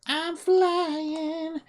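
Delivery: high, gleeful exclamation; amused disbelief. Real, not acted. Streamer noticing the glitch lets him fly, delighted. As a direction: marvel at the bug.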